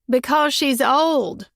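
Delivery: slow, grinning mock holler from across the kitchen; teasing holler. Mama teasing grandma from the kitchen island, hollered. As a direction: call it out teasingly. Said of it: "my mama hollered".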